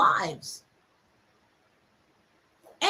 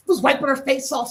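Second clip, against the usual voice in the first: usual speaking voice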